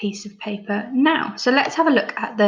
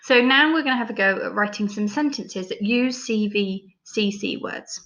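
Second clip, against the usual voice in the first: ordinary talk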